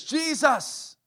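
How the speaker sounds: high, loud desperate plea; a begging shout of Jesus's name; desperate cry. Acted. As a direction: cry out his name with all she has. Woman crying out again, louder.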